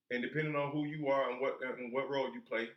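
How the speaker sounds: normal talking voice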